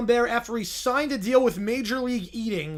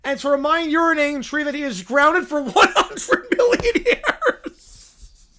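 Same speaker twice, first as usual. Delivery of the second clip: giggling as the last word jumps up; can't keep a straight face reading it; laughing through the vow; 5 semitones higher, 5 dB louder than usual. Acted. Officiant laughing mid-vow, last word squeaks up.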